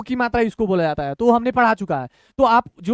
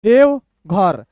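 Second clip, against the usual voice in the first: slow, clear gap between halves; broken in two; 2 semitones lower, 4 dB louder, much slower than usual. Shown as a demonstration. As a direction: read it two letters at a time. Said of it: "दो दो अक्षर तोड़ तोड़ के".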